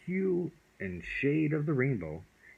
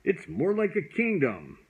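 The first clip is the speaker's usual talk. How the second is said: loud, higher grand proclamation; booming big voice; 9 semitones higher, 5 dB louder than usual. A narrator's reading. Dragon boasting of his kingdom, booming loud. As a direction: boom it out grandly. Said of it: "he boomed, in a great big voice".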